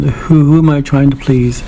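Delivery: ordinary talk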